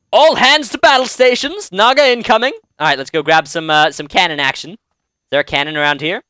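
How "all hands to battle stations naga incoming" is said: battle call. Acted. Acted captain calling crew to battle.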